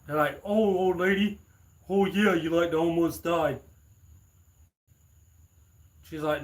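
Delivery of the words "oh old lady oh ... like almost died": mocking frat boys. Frat boys mocking old lady.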